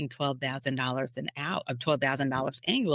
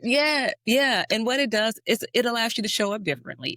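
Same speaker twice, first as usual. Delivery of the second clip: much higher, louder and fast; eager guest talking over the host; fired-up reply; 8 semitones higher, 5 dB louder than usual. Real, not acted. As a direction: rush in with excitement.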